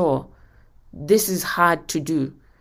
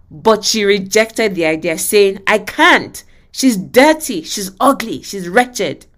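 louder, emphatic reading of a pastor's tale; dramatic story reading; 3 semitones higher, 5 dB louder than usual. Acted. Preacher reads Pastor Cho's story to the congregation, dramatic reading.

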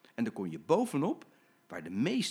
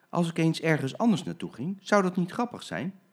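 higher, brightening with a naughty plan; sneaky idea; 6 semitones higher, 4 dB louder than usual. Acted. Jim hatching a naughty plan, rising excitement. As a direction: let the idea light you up. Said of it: "dacht Jim".

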